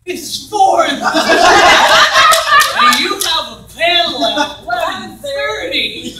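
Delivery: loud, high, laughing yell; mock yelling. Stratton yelling at [name] at night, laughing. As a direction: yell his name in mock shock. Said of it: "like, yelling at me".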